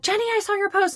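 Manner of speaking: high, singing-out greeting; excited client. Retold or imitated. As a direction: imitate a client calling out in delight. Client greeting her in store.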